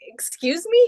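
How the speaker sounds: shocked high "Excuse me?"; offended squeak of surprise; indignant surprise. Retold or imitated. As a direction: leap way up in disbelief. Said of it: "And I was like".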